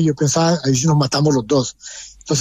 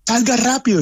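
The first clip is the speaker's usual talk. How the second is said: urging someone out, pitched up; retold shout; 8 semitones higher than usual. Son calling his father out of the collapsing clinic, high retold yell. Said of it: "me gritaba".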